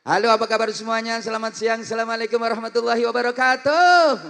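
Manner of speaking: high, fast showman hello; preacher opening with a concert greeting; singer's stage greeting. Shown as a demonstration. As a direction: greet the crowd like a pop star. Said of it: "salamnya gaya penyanyi".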